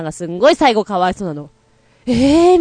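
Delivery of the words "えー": pitiful dad. Shown as a demonstration. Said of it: "みたいな".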